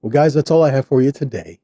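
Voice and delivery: ordinary talk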